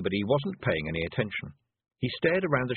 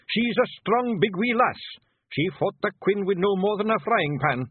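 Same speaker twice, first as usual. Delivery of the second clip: broad Scots brogue, lively and earnest; a wee blue Feagle warning his chief; Scottish pictsie voice; 9 semitones higher, 4 dB louder than usual. Acted. Feagle character voice.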